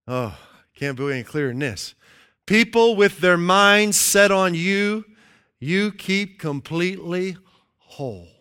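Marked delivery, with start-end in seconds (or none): 2.4-8.3 slow reading. Shown as a demonstration. Preacher reading The Message slowly, fading at the end. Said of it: "reads The Message slowly".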